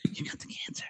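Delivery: barely audible repeated whisper; whispered bad news. Imitated doctor repeats diagnosis to patient, tiny whisper. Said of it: "they whisper bad things".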